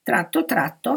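ordinary delivery, nothing marked

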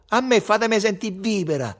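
high, insistent order; scornful demand. A narrator's reading. Ugo asks the band for his own song, scornful. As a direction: demand it over the other's choice. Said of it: "fece sprezzante Ugo".

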